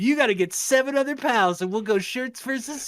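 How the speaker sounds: high, loud bro challenge; hammed-up jock daring the new kid; cocky jock. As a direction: puff up like a gym-class jock.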